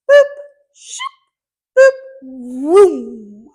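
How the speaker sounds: short boops, a swishing shoop, long loud vroom; drawing sound effects. Shown as a demonstration. Teacher drawing an uppercase D, making sound effects for strokes. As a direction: voice each pen stroke as a playful noise. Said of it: "we're gonna put a boop here".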